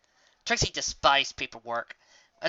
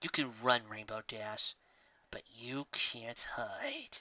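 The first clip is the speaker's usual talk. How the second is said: much softer and slow, low threat to herself; muttered growl; 4 semitones lower, 10 dB quieter, much slower than usual. Acted. Raindrops threatening the fleeing Rainbow Dash, muttered. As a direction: growl it under your breath. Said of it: "whispered to herself in a muttered growl".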